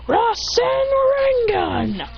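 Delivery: high-pitched anime attack call, falling away; attack name cry. Acted. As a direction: launch the move with a high cry. Hero calls out attack.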